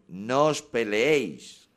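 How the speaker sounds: slow, loud scolding; parent's order. Retold or imitated. Parent scolding fighting siblings, slow loud order. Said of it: "tanto el padre como la madre".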